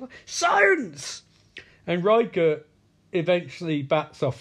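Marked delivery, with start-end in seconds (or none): 0.2-1.2 high, exaggerated cry; mock shout. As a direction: yell it as a hammed-up proclamation. Mimicking Caleb's exclamation.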